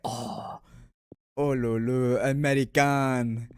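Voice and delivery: loud, drawn-out mock French accent; French guy. French friend reacting to meeting an American, loud French accent. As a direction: stretch it out like an impressed mocking Frenchman, French accent. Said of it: "the French guy would be like".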